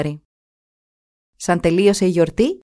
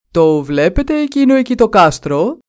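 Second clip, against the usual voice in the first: louder king's voice; a lightly voiced worried king; king asking; 3 semitones higher, 6 dB louder than usual. The king asks his courtiers about the castle, louder. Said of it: "τους ρώτησε ο βασιλιάς".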